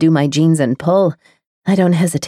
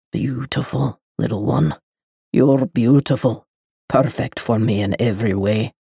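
low admiring male voice; 6 semitones lower, 4 dB quieter than usual. A narrator's reading. The lover admiring the girl, soft and low. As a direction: admire her quietly.

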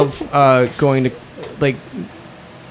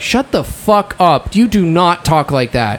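slow, emphatic telling-off, higher at first; retold scolding; 4 semitones higher, 3 dB louder than usual. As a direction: lay down the law to a friend.

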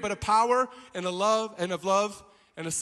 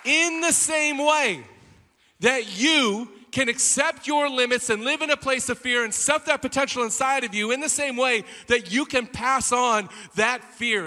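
raised, emphatic sermon delivery; full-force preaching; 5 semitones higher, 6 dB louder than usual. Acted. Preacher applying the flea story to listeners, full-force preaching. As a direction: drive the point home to the congregation.

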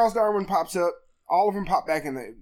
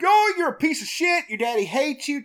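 mocking taunt; 8 semitones higher than usual. Possessed boy taunting his sister, mocking and higher. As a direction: sneer it like a bratty kid. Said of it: "so he's all like".

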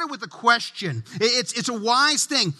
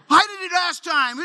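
put-on whiny kid protest; 7 semitones higher than usual. Child protesting to dad about whose turn, high whine. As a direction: complain like a put-upon kid.

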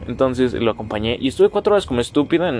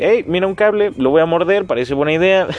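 high, louder cartoon rabbit thinking aloud; voiced rabbit; 5 semitones higher, 4 dB louder than usual. Podcaster imitates a rabbit about to bite a cable, high eager voice. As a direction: play an eager little animal.

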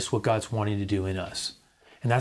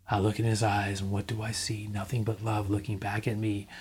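tender whisper; 3 semitones lower, 6 dB quieter than usual. Real, not acted. Recited line repeated softly.

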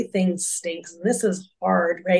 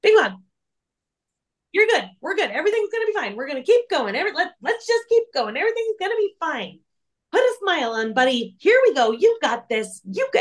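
high, bright-pushed cheerleader voice pitched way up; cheery Joy; 9 semitones higher, faster than usual. Retold or imitated. Joy cheering up the upset Bing Bong, high and chirpy. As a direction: force the cheer, higher and higher, to make it okay. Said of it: "Joy is over there going like".

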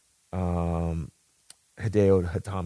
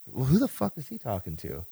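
muttered thought; 2 semitones higher, 9 dB quieter than usual. Retold or imitated. Confused inner thought.

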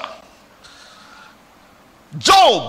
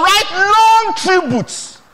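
drawn out, high and slow; slow emphasis; 8 semitones higher, 4 dB louder, much slower than usual. Acted. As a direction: stretch each word in scorn. Preacher mocks funeral tributes.

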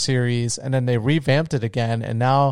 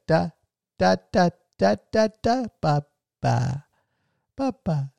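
imitated baby babble; 5 semitones higher than usual. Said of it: "And she's like".

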